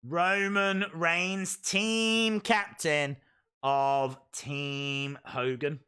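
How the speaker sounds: slow spaced-out proclamation; slow announcement. Pretend. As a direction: spell it out grandly. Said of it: "There we go".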